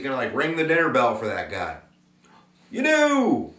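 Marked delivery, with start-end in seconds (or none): 2.7-3.5 a sudden high, triumphant exclamation; spring the answer on the class with glee; excited reveal. Real, not acted. Teacher revealing the answer to students, excited high exclamation.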